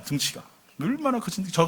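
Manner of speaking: ordinary delivery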